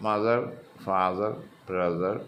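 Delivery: "മദർ, ഫാദർ, ബ്രദർ" with both syllables even and short; unstressed local pronunciation. Shown as a demonstration. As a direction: give "മദർ", "ഫാദർ", "ബ്രദർ" no stressed syllable, just run each together. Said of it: "ശരിയാവില്ല". ("മദർ, ഫാദർ, ബ്രദർ" stress ഇല്ലാതെ ഒരേപോലെ)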